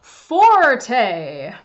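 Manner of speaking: slow, loud forte. Shown as a demonstration. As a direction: sing out forte loudly. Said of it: "special word to talk about loud sounds".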